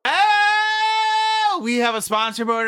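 ordinary delivery

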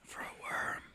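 unvoiced whisper. Real, not acted. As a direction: whisper the jab aside. Whispered snark.

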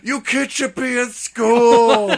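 very high, loud, drawn-out shout; hammed-up movie yell. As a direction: bellow slowly like an over-the-top villain scolding kids. Acts out Oldman yelling at kids.

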